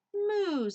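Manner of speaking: high loud cow moo; high mock moo. Cow answering Duck with a high moo.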